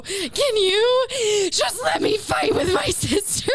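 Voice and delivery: high, drawn-out wailing plea; hammed-up frustrated crier; frustrated mock crying. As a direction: wail it in tearful exasperation. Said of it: "and I was crying".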